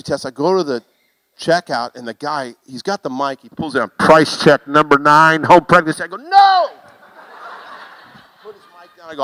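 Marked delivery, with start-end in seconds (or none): 4.0-6.0 loudspeaker announcement. Retold or imitated. Checkout guy on the mic. 6.2-6.7 sudden high yelled no; much higher and louder mock panic; panicked no. Panicked cry at the checkout.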